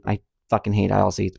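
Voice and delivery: ordinary delivery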